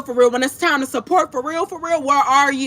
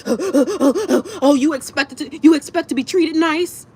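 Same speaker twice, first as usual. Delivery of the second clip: mocking; 4 semitones higher than usual.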